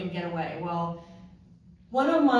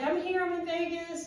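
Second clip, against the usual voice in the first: lightly chirpy, higher voice; upbeat retold news; 10 semitones higher than usual. Telling husband she has arrived, upbeat. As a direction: announce the arrival in a raised pitch.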